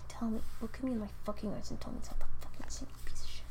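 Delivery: hissed mock threat, fast. Ranting to friend, whispered mock menace.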